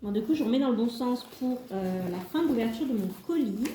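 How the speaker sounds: ordinary talk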